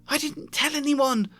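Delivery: high shrieking protest; shrieked. A narrator's reading. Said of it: "Hermione shrieked".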